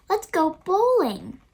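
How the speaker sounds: put-on a kid shouting out a fun idea; eager kid voice. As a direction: pipe up like an excited kid. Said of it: "Clara says".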